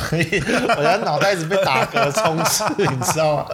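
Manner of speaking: talking through giggles; fighting back laughter mid-sentence; laughing. Real, not acted. Giggling over burps while talking.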